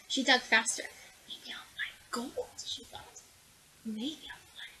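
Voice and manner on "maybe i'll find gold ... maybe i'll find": hushed, breathless whisper; girl whispering hopes in her hole. Acted. Secret hopeful thought.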